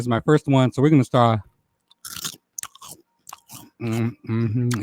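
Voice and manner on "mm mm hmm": soft mouth smacks with a hummed mm; quiet eating smacks and hum; ASMR lip smacking. Shown as a demonstration. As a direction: smack your lips for the mic. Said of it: "Y'all like that smacking?".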